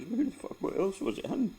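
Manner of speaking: high, loud outraged yell; retold indignant yell. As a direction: demand who did it, voice cracking high. Angry brother voiced in story.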